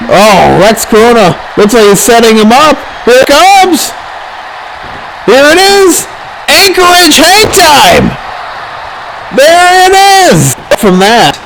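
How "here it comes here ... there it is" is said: high, building ringmaster shout; excited call. Real, not acted.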